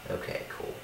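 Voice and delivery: whispered. Acted. Sulky mumbled acceptance.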